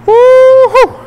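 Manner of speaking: whooping cheer. Real, not acted. Shout after the final lift.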